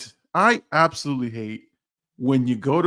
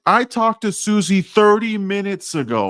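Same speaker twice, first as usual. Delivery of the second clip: exasperated retort; 7 semitones higher, 5 dB louder than usual. Retold or imitated. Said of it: "I'm like".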